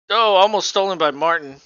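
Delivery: sudden excited play-by-play; excited call. Real, not acted. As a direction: call the near steal with a jolt. Live play-by-play near steal.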